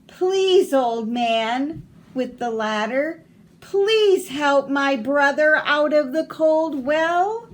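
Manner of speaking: high, loud, slow begging; pleading child. Acted. Little boy begs the old man to save his brother, loud. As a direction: beg the old man for help.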